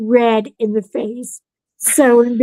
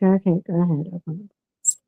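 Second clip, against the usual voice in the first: quiet resigned; 5 semitones lower, 6 dB quieter, faster than usual. Retold or imitated. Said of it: "I was like".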